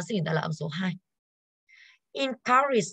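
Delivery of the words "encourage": "Encourage" with a high "cou"; stress on second syllable. Shown as a demonstration. Teacher checking stress with students, "Encourage" stressed high on "cou". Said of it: "âm số hai đúng không ạ?".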